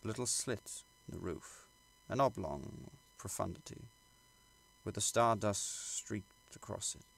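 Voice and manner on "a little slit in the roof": ordinary delivery, nothing marked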